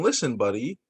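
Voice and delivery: ordinary delivery, nothing marked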